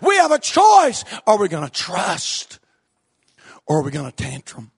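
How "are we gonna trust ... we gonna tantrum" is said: sudden drop to a near-hush; quiet, probing pulpit question. Real, not acted. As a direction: lean in and ask it softly. Preacher drops to hushed question.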